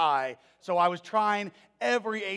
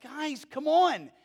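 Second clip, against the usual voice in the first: much higher, louder exasperated teacher; Jesus chiding disciples about the temple, higher and louder; 7 semitones higher, 3 dB louder than usual. Retold or imitated. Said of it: "And Jesus was like".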